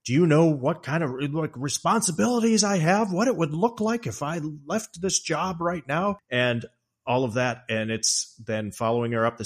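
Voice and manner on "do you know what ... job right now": higher, louder indignant Jack; hammed-up husband ranting at his wife; self-pitying rant. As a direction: whine angrily about his responsibilities. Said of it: "He's saying".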